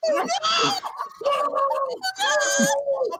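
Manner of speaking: loud, very high drawn-out cry; hammed-up high shriek of protest; wailing no. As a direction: wail as you are hauled off. Player wails as character is dragged away.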